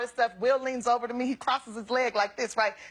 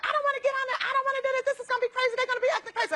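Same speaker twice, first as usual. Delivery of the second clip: high complaining friend voice; high whiny Jada; 10 semitones higher, much faster than usual. Retold or imitated. Jada refusing the swamp tour, high whine.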